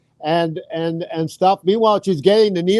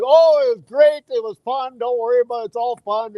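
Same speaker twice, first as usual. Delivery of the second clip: high, singsong fake reassurance; pretend fun; 5 semitones higher than usual. Daughter pretending to brother the needles were fun, fake cheerful. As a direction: put on a breezy, higher voice to cover up. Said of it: "pretend like you had the funnest time ever".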